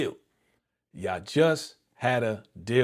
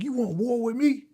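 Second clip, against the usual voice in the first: much higher-pitched, incredulous threat; heated mock confrontation; acted angry challenge; 12 semitones higher, 3 dB louder than usual. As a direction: imitate a furious man daring his rival. Acts out the boss's threat.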